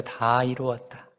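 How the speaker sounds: soft, slow and hushed; understated dying words; solemn last words. Retold or imitated. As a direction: let it fall quietly like a final breath. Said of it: "뭐라 그랬어요?".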